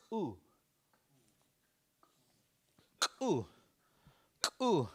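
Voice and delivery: ku with a hard, popped K; exploding K. Shown as a demonstration. Teacher modelling the word to give, exploding K. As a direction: burst the K in "ku". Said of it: "It's kinda like exploding K".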